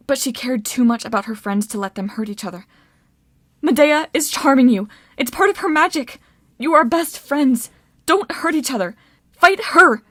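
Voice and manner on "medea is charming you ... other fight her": high-pitched urgent appeal; impassioned command building to a loud finish; desperate, emotional plea. A narrator's reading. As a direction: pour fear and anger into it. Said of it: "she poured everything into her words".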